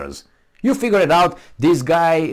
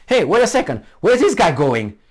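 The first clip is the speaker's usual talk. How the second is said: louder, higher mock wake-up call; sudden realization; 8 semitones higher, 4 dB louder than usual. Commenter imagining a follower waking up, higher, louder. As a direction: raise it like someone suddenly noticing his chains. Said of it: "it'd be like".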